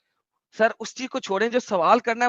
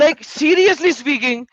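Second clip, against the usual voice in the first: very slow, much higher incredulous drawl; exasperated; 7 semitones higher, 5 dB louder, much slower than usual. Real, not acted. Ranting to co-host about the caller, incredulous. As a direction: stretch it out in disbelief.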